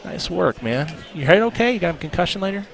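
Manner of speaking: loud. Real, not acted. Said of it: "we had to bring the volume".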